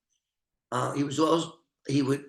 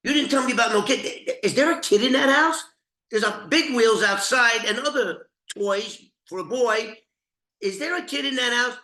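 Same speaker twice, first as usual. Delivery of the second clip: raised-pitch, pushy retelling of a suspicious gangster; Mad Dog on the phone; 8 semitones higher, 4 dB louder than usual. Retold or imitated. Mad Dog calling Brian from a payphone, voiced high and demanding. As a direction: press him hard, like a mobster demanding answers. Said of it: "And he tells him".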